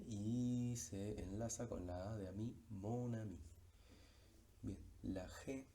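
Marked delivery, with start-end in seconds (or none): 2.7-3.4 "mon ami" with the N sounded and linked to ami; N liaison. Shown as a demonstration. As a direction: keep the N of "mon" and glide into "ami". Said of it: "la N de mon aparece". ("mon ami" pronunciando la N enlazada)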